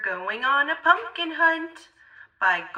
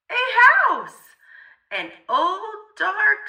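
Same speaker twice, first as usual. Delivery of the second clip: surprised exclamation; 7 semitones higher, 4 dB louder than usual. A narrator's reading. Scary discovery in story.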